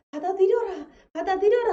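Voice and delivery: loud, high repeated command, growing; a preacher voicing the Spirit's loud command; commanding shout. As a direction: thunder the order to accept.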